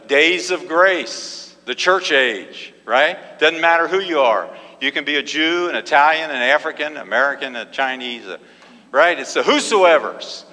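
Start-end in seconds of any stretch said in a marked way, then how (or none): none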